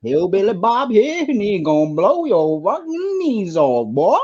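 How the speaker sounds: high, slow Southern drawl; hammed-up hillbilly threat in Southern accent; mock hillbilly accent. Guest as Hillbilly Bob threatening the host, mock Southern accent.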